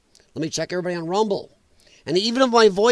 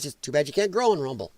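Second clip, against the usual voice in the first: ordinary delivery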